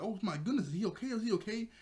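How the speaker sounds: lightly acted alarm, pitch lifted; mock concern. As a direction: play a fretting bystander. Acts out worried onlookers.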